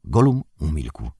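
ordinary delivery

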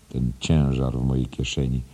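usual speaking voice

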